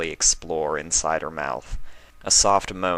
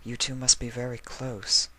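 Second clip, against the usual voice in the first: higher, softer voice for the sweet mare; a soft, higher Octavia, stunned; 6 semitones higher, 5 dB quieter than usual. Acted. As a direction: voice Octavia gently shocked.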